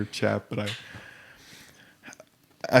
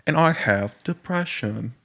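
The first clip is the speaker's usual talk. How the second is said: mock British accent; 6 semitones higher, slower than usual. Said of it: "Or British accent?".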